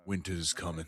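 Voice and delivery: put-on a hesitant, understated go at the Jon Snow accent. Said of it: "I can't do the accent".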